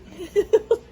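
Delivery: short high giggle at herself; embarrassed laugh. Real, not acted. Teacher laughing after the page blew open, high embarrassed giggle. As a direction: laugh off the slip.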